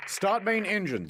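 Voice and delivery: high-pitched acted order; radio command. A narrator's reading. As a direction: call the order out high, at normal volume. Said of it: "commands over the radio".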